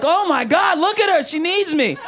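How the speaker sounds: hammed-up pity for a crying woman. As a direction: gush like a rescuer finding a victim. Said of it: "You're like".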